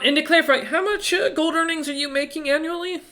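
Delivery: banker questioning. Acted. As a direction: ask the figure like a nosy clerk. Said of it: "looking at you and goes".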